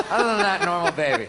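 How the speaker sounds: laughing. Real, not acted. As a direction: giggle through the words. Laughing through the line.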